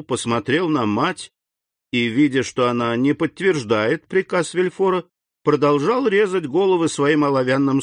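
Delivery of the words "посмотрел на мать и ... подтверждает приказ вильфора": ordinary delivery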